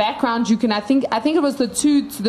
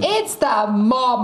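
IT'S THE MOM stretched out slowly, word by word; drawn-out insistence; much slower than usual. Retold or imitated. Viewer insisting on her killer prediction, drawn-out insistence. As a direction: draw out "It's the mom" for emphasis.

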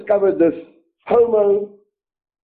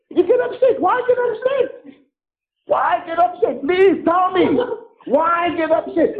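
sarcastic; 8 semitones higher than usual. Real, not acted. Sarcastic high pleading.

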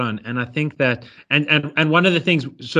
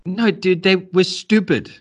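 pitched-up, slightly louder mock retort; mock dude voice, higher and drawn out; mock blunt answer; 7 semitones higher, 3 dB louder than usual. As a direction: answer like an exasperated dude, hammed up lightly. Mock answer to quoted question.

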